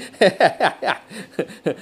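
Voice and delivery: helpless run of chuckles; amused laugh. Real, not acted. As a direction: laugh at your own tongue-tangle. Laughs at tongue-twisting Chinese phrases.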